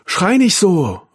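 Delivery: loud, high scolding call. A narrator's reading. Mutter ruft von unten zurück, laut tadelnd. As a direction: scold from downstairs. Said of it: "rief Marie-Therese von unten".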